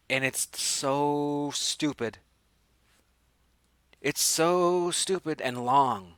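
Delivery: slow, exasperated drag on "so"; drawn-out exasperation. Real, not acted. Host judging the stupid, long death scene, exasperated.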